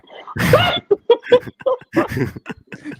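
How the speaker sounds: shrill cackling laugh; loud high laugh. Real, not acted. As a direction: burst out laughing. Laughing at own joke.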